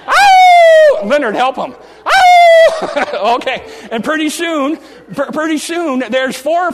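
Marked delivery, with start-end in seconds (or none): 0.1-1.0 high, loud sustained howl; dog howl. Acted. Preacher playing dogs that join in, howling. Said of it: "they join in". 1.7-3.3 high loud howl breaking into a laugh; dog howl. Acted. Preacher demonstrating dogs joining in, howling. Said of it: "they join in".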